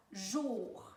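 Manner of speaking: JOUR stretched as one separate syllable; syllable sounded out. Shown as a demonstration. Teacher showing the first syllable of journée, JOUR sounded out. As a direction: draw out JOUR on its own. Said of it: "je prononce la première syllabe".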